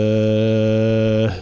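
وزكاة with the long vowel held and a final h; slow drawn وزكاة; long madd pause. Shown as a demonstration. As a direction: stretch the alif of وزكاة long, stop on h. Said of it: "أن نقف عليها بالمد الطويل".

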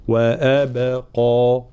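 "وأبقى" very slowly, thin واو, heavy قاف only; correct pronunciation. Shown as a demonstration. Teacher repeating the correct word, slow. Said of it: "هكذا".

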